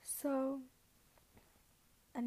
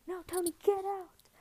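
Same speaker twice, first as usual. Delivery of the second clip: high, loud retold outburst; mock urgent order; 7 semitones higher, 6 dB louder than usual. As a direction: act out shooing a lover out. Acting out urgent order to leave.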